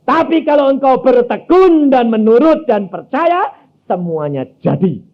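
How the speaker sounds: high, loud pulpit proclamation; full-force preaching. Acted. Preacher driving the point home to the church, loud preaching. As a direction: preach it out to the congregation.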